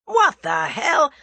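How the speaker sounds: slow, loud shouted rage; raging outburst. A narrator's reading. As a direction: let the pent-up rage burst. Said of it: "pent-up rage burst forth".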